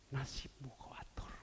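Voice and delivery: whispered "Nasibmu kau atur", breath only. Acted. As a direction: hush it out like a cold verdict. Repeated line, first whispered.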